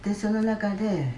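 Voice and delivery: ordinary delivery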